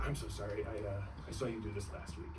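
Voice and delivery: soft, sheepish apology; hushed, polite sorry; softly apologetic aside; meek apology. Retold or imitated. Apologetic quiet aside to performer.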